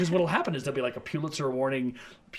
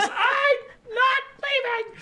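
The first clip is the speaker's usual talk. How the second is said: very high, stretched-out mock cry; hammed-up defiant wail; 4 dB louder, much slower than usual. Teacher refusing FBI orders to leave the tree, mock wailing. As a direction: shriek it slowly in play defiance.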